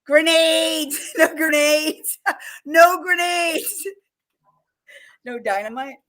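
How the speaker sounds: high, slow, laughing through the words; laughing while quoting. Retold or imitated.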